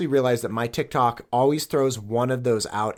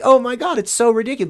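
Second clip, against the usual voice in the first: high, loud gush of disbelief; excited, over-the-top retold amazement; amazed reaction; 10 semitones higher, 5 dB louder than usual. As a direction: act out being blown away, pitch jumping up. Retelling his amazed reaction to virality.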